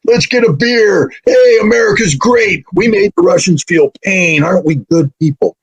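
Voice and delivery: high, mocking frat-boy gloat; gloating Americans. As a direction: ham up smug high-fiving Americans.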